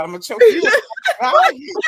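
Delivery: high, loud, laughing threat; laughing mock threat. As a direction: playfully threaten to choke him, cracking up. Joking threat to the suitor.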